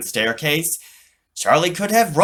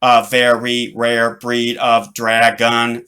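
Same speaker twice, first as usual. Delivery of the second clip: slow, loud, mechanical; robot voice; 6 dB louder, slower than usual. Acted. Robot informs Charlie, slow and mechanical. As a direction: state it like a machine. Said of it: "said the robot".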